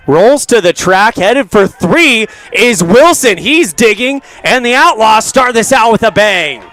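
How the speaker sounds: rising, loud excited call; excited play-by-play. Real, not acted. Live home run call.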